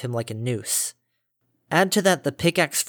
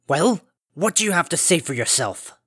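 stern demand; 4 semitones higher than usual. Acted. Father demands an answer.

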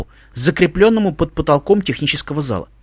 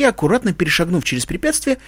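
ordinary delivery

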